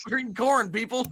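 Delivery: high, loud, fast announcing voice; loud excited shout. Acted. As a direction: belt it out like a party host. Host repeats line even bigger.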